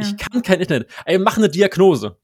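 loud, fed-up demand; exasperated demand. Retold or imitated. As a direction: push back, exasperated. Retold demanding diagnosis.

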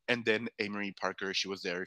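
ordinary talk, nothing marked

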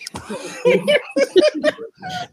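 long, high peal of laughter; high cackling laugh. Real, not acted. Panelist reacting to a blunt "No", high cackling laugh. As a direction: burst into high, helpless laughter.

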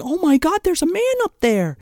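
a hint of a bystander pointing up in shock; mock alarm. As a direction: crowd member's imagined alarm. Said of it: "They're not going".

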